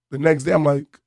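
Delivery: ordinary talk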